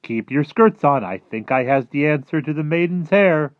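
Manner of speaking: high, loud giant voice; eager friendly giant. Acted. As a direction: break in eagerly as the giant with an idea. Voices the BFG.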